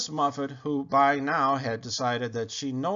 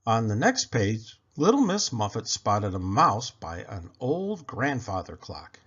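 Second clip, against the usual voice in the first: plain reading voice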